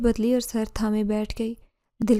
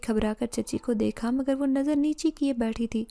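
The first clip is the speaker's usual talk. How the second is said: plain reading voice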